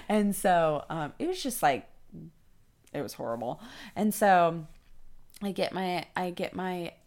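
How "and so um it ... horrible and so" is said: ordinary talk, nothing marked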